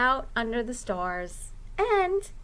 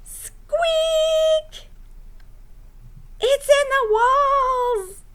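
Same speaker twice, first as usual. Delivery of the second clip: high, loud screech, slow on the walls; screeching owl; 5 dB louder, much slower than usual. Acted. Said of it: "Owl screeched".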